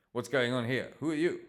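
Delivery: sharp, high, loud challenge; loud demand. Acted. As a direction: call out suspiciously. Borg bursts in demanding.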